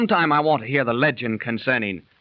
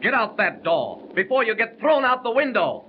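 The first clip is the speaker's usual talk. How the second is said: high, heated order to get out; furious threat to the card cheat; angry threat; 10 semitones higher than usual. Acted. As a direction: throw him out with raised fury. Throws the cheat out.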